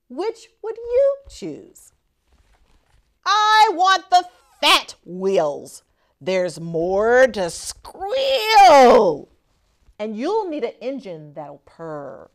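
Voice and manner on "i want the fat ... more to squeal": loud, eager hamster wanting fat wheels; eager choice. Acted. Hamster telling the mouse his pick, loud and eager. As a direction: stretch it out with glee.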